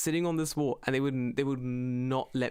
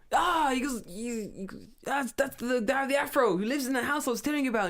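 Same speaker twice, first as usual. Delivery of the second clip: put-on old man shouting; 9 semitones higher than usual. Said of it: "And he goes".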